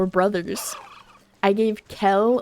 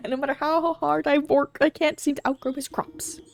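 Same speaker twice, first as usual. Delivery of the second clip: put-on higher-pitched German-accented farmer; German-style accent; 6 semitones higher than usual. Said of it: "differentiate a V and a Z".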